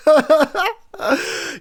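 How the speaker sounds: cackling laugh; loud high laugh. Real, not acted. Host cracking up at his own joke, loud high laugh. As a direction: burst out laughing.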